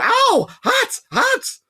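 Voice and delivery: very high, loud yelp of pain; pained yell. A narrator's reading. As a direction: yelp as the whip burns. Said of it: "he yelled".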